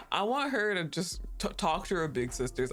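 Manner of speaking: ordinary talk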